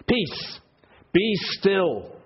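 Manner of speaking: calm the storm with one command; commanding Jesus. A narrator's reading.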